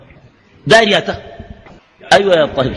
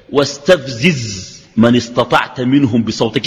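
Quranic recitation; 6 semitones lower, much slower than usual. Shown as a demonstration.

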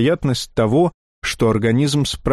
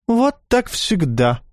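high, slow mock lament; theatrical sigh; 7 semitones higher, slower than usual. A narrator's reading. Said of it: "девушка картинно вздохнула". (театрально вздыхая)

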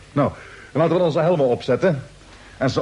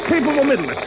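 commanding demand; 8 semitones higher, faster than usual. Acted. Captain demands Peterson.

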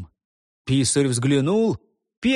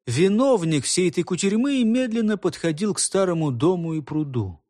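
ordinary delivery

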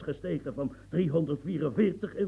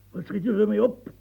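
raised challenge; 4 semitones higher, 6 dB louder than usual. Acted. Repeated angry challenge to Louis.

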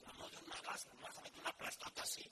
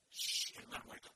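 hissing hose sound; 8 dB louder, slower than usual. Retold or imitated. Hosing salt water into sea.

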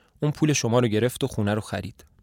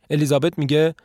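plain reading voice

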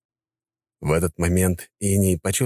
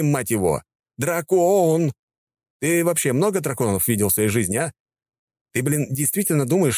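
exasperated rant; 7 semitones higher than usual. Acted. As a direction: let it all out in disbelief.

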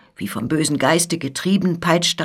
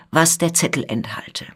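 ordinary delivery, nothing marked

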